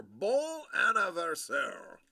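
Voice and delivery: drawn-out mock French accent; make-believe French accent. Streamer wishing happy birthday in French, make-believe French accent. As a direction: play at a French accent, stretching it out slowly. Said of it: "That is my make-believe French accent".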